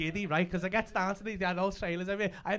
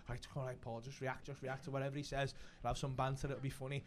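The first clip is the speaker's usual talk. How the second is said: soft, low talking to himself; self pep talk; 5 semitones lower, 9 dB quieter than usual. Retold or imitated. Actor readying himself for his big moment, hushed self-coaching. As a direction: psych yourself up under your breath.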